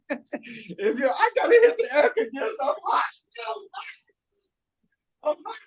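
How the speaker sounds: high-pitched giggling through the words; laughing too hard to talk straight. Real, not acted. Laughing fit, overheated mid-story.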